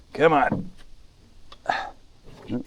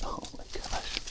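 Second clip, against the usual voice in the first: whispered; 11 dB quieter than usual. Real, not acted. Squeezing under branches in creek.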